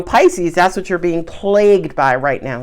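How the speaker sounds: ordinary talk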